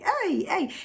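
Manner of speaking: high, lightly voiced double "hey"; demonstrating expressive high interjections; animated African exclamation. Shown as a demonstration. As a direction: exclaim twice, pitched well up. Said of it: "I throw my hands so much in the air".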